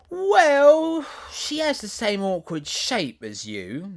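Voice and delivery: high, loud prim flower voice; prissy gossiping rose. Acted.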